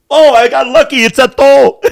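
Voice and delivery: squeaky relieved patient; high-pitched patient voice. Retold or imitated. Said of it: "The guy's gonna be like".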